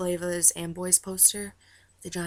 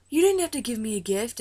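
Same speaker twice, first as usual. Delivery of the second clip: louder, higher mock protest; imitated touched protest; 5 semitones higher, 7 dB louder than usual. Star receiving a fan's gift, imitated loud, higher protest. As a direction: exclaim it high and loud, as if touched.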